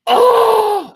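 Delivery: high mock yelp of pain; retold scream. Friend in the shower yelping, high mock scream. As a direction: yelp it out like sudden burning pain.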